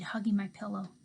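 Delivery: usual speaking voice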